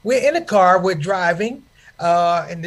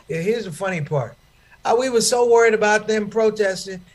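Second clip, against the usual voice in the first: ordinary talk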